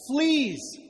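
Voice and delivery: sudden high, loud yell of alarm; screamed exclamation. Acted. Corey screams to the barracks from her flea-ridden bed, screamed. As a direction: cry it out at the top of your lungs. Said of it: "screamed at the top of her lungs".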